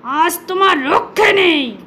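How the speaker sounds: a lightly growled angry lion voice; irritated lion scolding the mouse; roaring lion. Acted. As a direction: play the lion, mildly menacing. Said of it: "সিংহ হুংকার ছেড়ে বলতে লাগলো".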